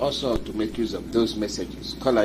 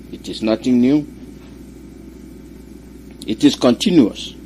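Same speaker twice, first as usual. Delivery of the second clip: loud rallying radio sermon; forceful preaching; 3 dB louder than usual. Real, not acted. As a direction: drive each line home with force.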